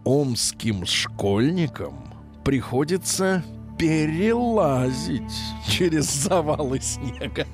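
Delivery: every word drawn out and weighed; slow mock reading. Shown as a demonstration. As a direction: read it slowly like a ridiculous headline. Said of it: "как это звучит?".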